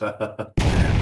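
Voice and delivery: loud mocking laugh. Real, not acted. Mocking the traders.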